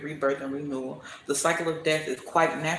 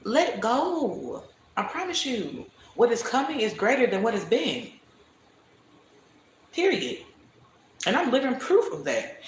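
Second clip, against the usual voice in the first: emphatic preaching; 7 semitones higher than usual. Real, not acted.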